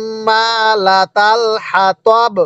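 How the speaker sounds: long hummed double m in হাম্মালাতাল; slow tajweed reading. Shown as a demonstration. Teacher showing how to read with a stop, long nasal hum in হাম্মালাতাল. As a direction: stretch হাম্মালাতাল on a held nasal m, stop crisply on হাতব. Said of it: "এরকম ভাবে শিখব".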